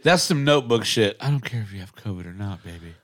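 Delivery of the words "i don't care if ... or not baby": mock tender. Mock romantic line to wife.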